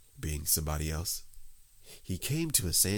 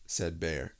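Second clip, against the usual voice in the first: plain reading voice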